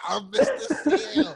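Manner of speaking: slow, very high, loud wail; imitating a hero's despair, laugh in the voice; comic anguish. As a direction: draw it out as a hammed-up lament. Retold hero laments missing sale.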